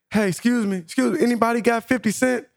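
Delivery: high pleading voice; mimicking the woman. Narrator imitating the woman asking for fifty cents, high-pitched. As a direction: ask like you're begging for change.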